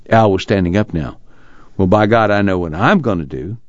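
ordinary delivery

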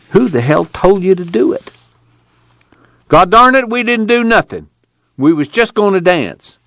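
high indignant prisoner protesting innocence; angry denial. A narrator's reading.

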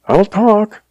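high frightened giving in; blubbering. A narrator's reading. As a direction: surrender in terror, pitched high. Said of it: "he blubbered".

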